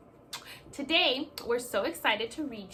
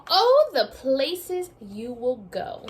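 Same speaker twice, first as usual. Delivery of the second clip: slow, louder storybook refrain; dramatic title read; 2 semitones higher, 10 dB louder, slower than usual. Acted. Teacher reading the refrain aloud to her class, slow and grand. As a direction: savour the title slowly for the kids.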